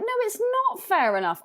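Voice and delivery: high, quick objection; indignant protest. Acted. Answering the guy who gave in to Bale, indignant. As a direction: object sharply, pitch jumping up. Indignant retort to the crew's acquiescence.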